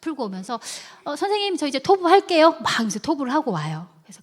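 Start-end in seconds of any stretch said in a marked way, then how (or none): 1.0-2.6 high, loud pupil's announcement; raised-pitch mimicry of a pupil; eager child, imitated. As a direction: pipe up as a keen child telling the teacher. Child's eager promise acted out.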